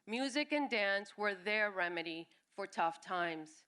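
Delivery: ordinary delivery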